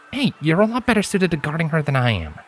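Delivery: a grinning friend talking her round; Lens, easygoing stallion. A narrator's reading. Lens urging Scarlet to rest, easygoing.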